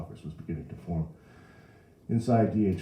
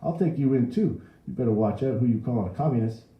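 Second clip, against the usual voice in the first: raised, loud warning; angry cop's threat; threatening policeman; 7 semitones higher, 7 dB louder than usual. A narrator's reading. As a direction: threaten arrest with ruffled authority. Policeman threatens the old man.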